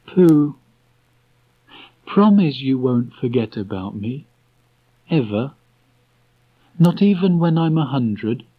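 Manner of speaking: put-on high, halting boyish voice; hesitant boy. A narrator's reading. Christopher Robin trying to tell Pooh, high halting voice. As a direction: stumble over the words like a shy child.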